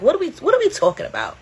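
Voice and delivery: what are we even talking about, rising high; incredulous. Real, not acted. Vlogger scoffing at a friend's hotel complaint, high incredulous voice. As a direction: pitch jumps high in disbelief.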